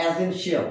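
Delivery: "essential" said as "e-sen-shi-al"; common mispronunciation. Shown as a demonstration. Teacher warning learners off the wrong form, "essential" as e-sen-shi-al. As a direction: say "essential" with a full "shi-al" ending.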